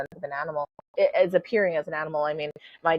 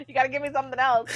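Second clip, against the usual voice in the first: higher, fast, exasperated plea; 8 semitones higher, faster than usual. Retold or imitated. To her mom on the phone, a fast, exasperated plea. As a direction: rush it out, begging for a real clue.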